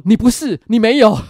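loud, laughing reassurance. Retold or imitated. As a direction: shout back to cheer him up. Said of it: "他马上跟着我说". (路人大声安慰)

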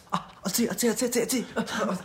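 high stammering gasps from numbing heat; mouth burning from spice. Retold or imitated. Ken eating the spicy beef noodles, high burning stammer. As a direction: stutter like your mouth is on fire. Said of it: "他就边吃啊".

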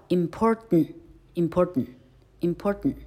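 important with a swallowed final n; syllabic n drill. Shown as a demonstration. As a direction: repeat important, vowel dropped before t. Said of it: "repeated drill".

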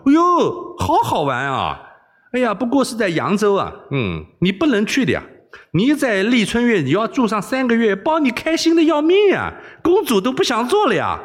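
high, gleeful hard sell; gushing enthusiasm. Acted. Wei Xiaobao luring the princess with brothel fun, gushing. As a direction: rave up high to tempt a naive listener.

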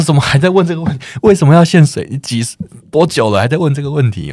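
retelling snapping back at her parent, exasperated; exasperated retort. Retold or imitated. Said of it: "我说".

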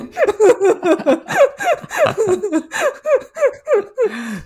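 long high falling laugh. Real, not acted. Laughing at herself for still getting scammed, real laughter. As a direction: laugh it off at yourself.

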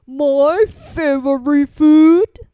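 loud, slow monster voice; big Gruffalo. Acted. Gruffalo sizes up the mouse as food, big monster voice. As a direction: boom it out slowly as a monster.

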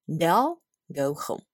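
slow "They'll" with a clear final L; slow model reading. Shown as a demonstration. Teacher drilling the contraction "They'll". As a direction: drill it slowly. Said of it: "就会变成".

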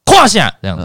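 跨 shouted high and loud, 线 dropped back; a sharp yell hitting 跨; stress on first word. Shown as a demonstration. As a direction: bark 跨 hard, let 线 fall. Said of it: "第一个字一定要重音".

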